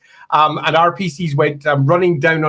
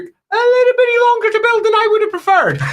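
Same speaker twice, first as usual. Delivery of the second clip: put-on, very high-pitched complaint; mock grievance way up high; high comic grumble. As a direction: jokingly grumble in a high, squeaky voice. Comic grumble about his workload.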